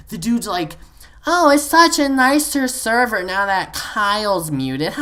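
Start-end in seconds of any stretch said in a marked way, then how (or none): 1.2-4.9 high, slow, loud mimicry; mock sarcastic player. Storyteller imitating Kyle testing the mute, slow mock sarcasm. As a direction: drag out the fake pleasantness.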